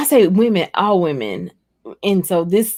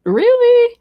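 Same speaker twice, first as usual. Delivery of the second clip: very high, louder disbelief; surprised; 3 dB louder, much slower than usual. Retold or imitated. The host recalls hearing a viewer's question, very high and incredulous. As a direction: shoot up in disbelief on both words. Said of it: "And I was like".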